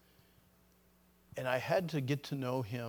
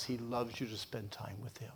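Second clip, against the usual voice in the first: normal talking voice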